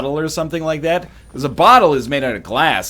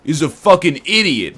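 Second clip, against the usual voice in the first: louder, higher mock outrage at ham on pizza; mock Sinvicta rant; 9 semitones higher, 6 dB louder than usual. Mocking a friend's rant about ham on pizza, loud and scornful. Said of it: "Sinvicta was like".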